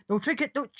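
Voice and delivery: high calling voice, pitched well up; lady calling off her bear. Acted. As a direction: call the bear off, high and urgent. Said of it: "and you hear".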